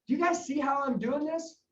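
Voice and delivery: ordinary delivery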